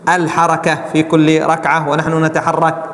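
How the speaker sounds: ordinary delivery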